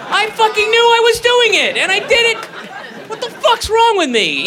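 frustrated outburst. Acted. Acted self-scolding after locking keys.

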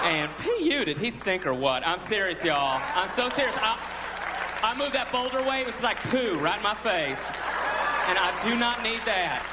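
put-on lightly campy Southern accent, gossipy; mild effeminate Southern accent; effeminate Southern Jesus. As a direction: gossip like a Southern queen about Lazarus. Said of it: "Think of Jesus going".

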